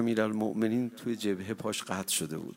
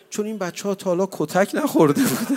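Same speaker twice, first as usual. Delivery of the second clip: higher, louder, faster cry; anguished outburst; 7 semitones higher, 8 dB louder, faster than usual. Real, not acted. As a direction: let the grief spill out quickly.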